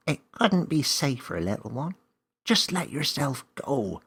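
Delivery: small wise creature explaining the rules; magical helper creature. Acted. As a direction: play a confident little magical guide. Name reassures.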